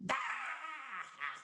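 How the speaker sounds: soft, thrown-high cackle; super high-pitched laugh. Shown as a demonstration. Showing the host the laugh that nearly ruptured his throat, high-pitched. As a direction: throw the laugh right up high, held down in volume. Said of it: "super high pitched, and throw it".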